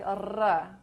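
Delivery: "R" as a rolled tongue trill; sustained Spanish trill on "R". Shown as a demonstration. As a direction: roll the R with the tongue tip. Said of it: "is trilled, like".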